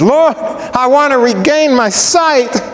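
high, slow, drawn-out plea; pleading blind man. Acted. Blind man answering Jesus, high and pleading. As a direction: plead earnestly for your sight. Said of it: "And he said".

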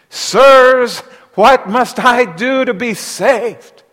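pleading cry, high and loud, slow; desperate jailer. Acted. Trembling jailer begs Paul and Silas, loud plea. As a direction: beg them like a frightened man. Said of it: "he said to them".

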